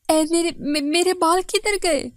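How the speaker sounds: loud, high stammering cry; distraught wail. A narrator's reading. Wife discovering her hair is gone, distraught wail. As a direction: wail as if about to burst into tears. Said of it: "गुड़िया जोर-जोर से रोने लग गई".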